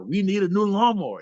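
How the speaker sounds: hammed-up husband pushing for a new lawnmower; escalating husband. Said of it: "his voice is getting louder".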